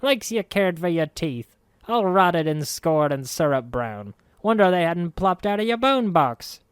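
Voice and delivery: high, loud indignant hag; indignant crone. A narrator's reading. Marta scolding the ghost, high indignant crone voice. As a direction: puff up and scold. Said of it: "puffed up indignantly".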